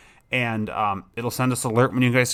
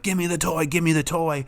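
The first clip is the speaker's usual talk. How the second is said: pleading a bit higher, mimicking a hyped-up handler; mock eager begging; 5 semitones higher than usual. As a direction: beg for the toy like an overexcited competitor.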